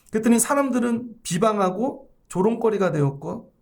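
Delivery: usual speaking voice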